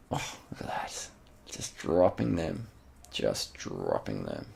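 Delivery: very slow, stretched words; drawn-out marvelling. Real, not acted. Commentator watches rivals left behind, slow drawn-out marvelling. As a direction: draw out every word, letting it sink in.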